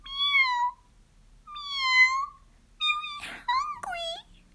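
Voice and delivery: very high squeaky kitty voice; meowing cats. Acted. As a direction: mew like a thirsty kitten. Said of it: "said the hundreds of cats".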